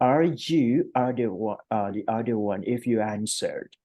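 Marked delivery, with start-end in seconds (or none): none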